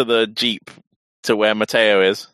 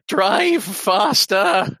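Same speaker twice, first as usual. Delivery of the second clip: scream; 9 semitones higher, much slower than usual. Acted. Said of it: "and I scream".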